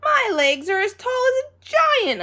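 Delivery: high, loud amazed whoa; wow-struck child marvelling at her legs; amazed kid. A narrator's reading. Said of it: "I said".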